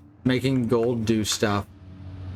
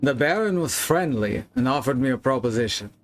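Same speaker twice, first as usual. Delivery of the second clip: put-on Wilhelm's letter in a clumsy foreign accent; bad put-on accent. Player reads Wilhelm's final confession aloud, bad put-on accent. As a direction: read it formally with a badly faked accent. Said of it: "I apologize for my bad accent".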